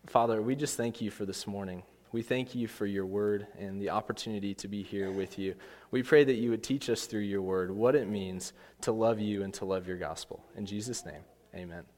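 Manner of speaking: hushed congregational prayer. Real, not acted. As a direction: pray quietly and sincerely, letting the amen drop away.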